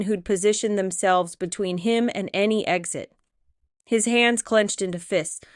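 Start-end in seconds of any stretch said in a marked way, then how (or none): none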